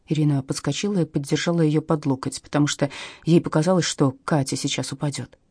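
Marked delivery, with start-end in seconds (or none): none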